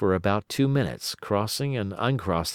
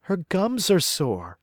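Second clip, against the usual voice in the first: higher, slow, gentle woman's voice; placating; 9 semitones higher, slower than usual. Acted. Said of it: "Maria tried to placate him".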